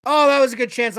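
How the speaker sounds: blurted out loud at a near goal; excited exclamation. Real, not acted. Fan reacting live to a scoring chance, loud and excited. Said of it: "I just turned off my mic".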